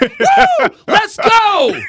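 high, loud whooping shout of a fan going nuts; wild fan cheer. Retold or imitated. Stadium fan celebrating a big catch, screaming wildly. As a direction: scream it like a stranger leaping out of his stadium seat.

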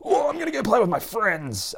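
very high, far-from-natural dog voice; hammed-up excited dog; overexcited dog. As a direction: squeal like a dog bursting to play. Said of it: "instead of getting to the park and them going".

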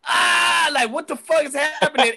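high alarmed yelp; high loud retold panic; startled panic. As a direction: sound caught off guard. Narrator startled by ambush.

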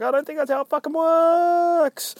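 very high-pitched mock protest; objecting security guard.

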